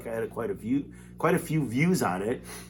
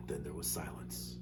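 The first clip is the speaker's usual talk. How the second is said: whisper; 7 semitones lower, 16 dB quieter than usual. A narrator's reading. Narrator hushes the moment.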